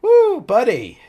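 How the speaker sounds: high loud woo; exclaiming. Real, not acted. Reader reacting to wild chapter, high loud woo.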